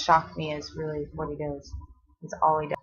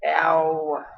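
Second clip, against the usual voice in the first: sudden ow of pain; 5 dB louder than usual. Real, not acted. Baby kicks her rib.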